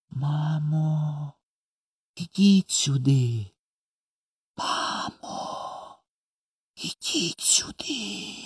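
very soft, high, slow, drawn-out call; dreamy hushed calling. A narrator's reading. Brother shows how to call mama quietly, dreamily hushed. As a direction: call as if through sleep, fading to almost nothing. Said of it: "одними губами, мов крізь сон, почав кликати".

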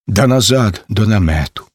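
usual speaking voice

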